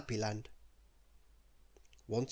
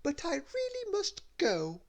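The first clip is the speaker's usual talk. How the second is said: much higher, snooty lady voice; haughty exit. Acted. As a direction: brush him off. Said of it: "turning her nose up".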